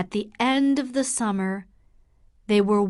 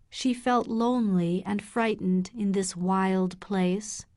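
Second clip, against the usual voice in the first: plain reading voice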